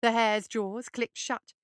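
plain reading voice

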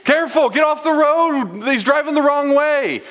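high-pitched, loud frantic wife; panicked shout. Acted. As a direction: shriek an urgent warning down the phone. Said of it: "calls him in a panic".

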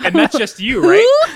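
loud, high rising question; excited. Real, not acted. Teasing question, rising shout.

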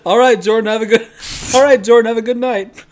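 mock goodbye, laughing and loud; coworker's goodbye imitated while cracking up; laughing through the quote. Friend retelling a coworker's goodbye, laughing loudly.

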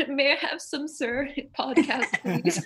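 playful pauper's plea; mock begging. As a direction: plead for scraps, laughing through it. Jokingly begs like a hungry orphan.

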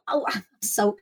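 ordinary delivery

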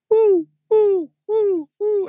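high loud hooting owl call; mimicked owl hooting. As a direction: hoot like a big owl overhead. Owl hoot heard at night.

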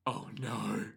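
soft, dropped oh no; quiet dismay. Retold or imitated. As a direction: let it sink out quietly. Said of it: "Anasui then is like".

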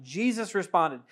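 normal talking voice

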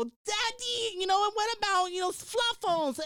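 put-on little girl. Little girl asks her daddy in a high child voice. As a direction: ask daddy like a small child. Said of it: "this little girl go".

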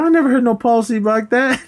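high-pitched disbelieving retort; imitated woman objecting. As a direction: dispute it in a high mock female voice. Woman disputes guard's made-up policy.

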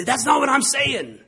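mocking a touchy friend. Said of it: "he would still say".

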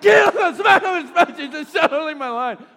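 high, loud mock outburst at his son; hammed-up angry yell at the kid; a mock furious high yell at the son; mock furious shout. I'm like.

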